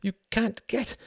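high, halting frightened stammer; panicked pleading. A narrator's reading. The Count learns he will be shot, panicked pleading. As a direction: stammer in rising panic for your life.

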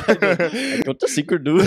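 put-on laughing Indian accent, slow and high. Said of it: "why did I sound Indian there".

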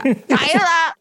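high called name; calling out name. Retold or imitated. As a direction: call her name up high.

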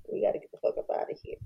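a fast, voiceless whisper to each other; urgent whisper. Retold or imitated. The twins whispering to each other about getting out, urgent whisper. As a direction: hiss it fast so no one else hears. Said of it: "twins looking at each other like".

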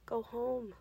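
a far-off ravine echo; quiet echo. A narrator's reading. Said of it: "reverberated quietly".

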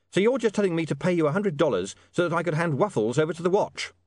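lightly voiced, sceptical William.

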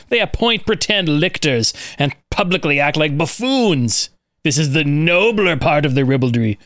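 put-on lightly theatrical reading of an old text; dramatic reading. Host reads angry ancient critic to co-hosts, mock outraged. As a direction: read it like an indignant Roman moralist. Reads a Roman quote aloud.

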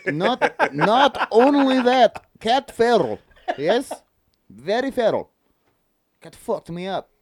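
high, emphatic mock Russian; hammed-up Russian storyteller; Russian accent. Telling a cat story on request, high emphatic Russian accent. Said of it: "tell me a story in Russian".

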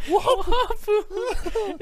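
slow, high drawn-out "Wahapa"; silly high squeak. Acted. Repeating sound effect.

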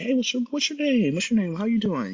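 high, loud, chirpy hello; retold friendly greeting. Bystander starts chatting with young man, bright greeting. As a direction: greet a stranger with big cheer.

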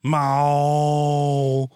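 loud drawn-out wailing meow; long loud meow to the cat. Shown as a demonstration. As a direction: yowl it like a cat crying. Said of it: "Those are the noises I make at my cat".

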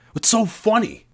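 very high, indignant question; annoyed. A narrator's reading. Narrator asking the giggling girl, indignant. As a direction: ask it all put out.

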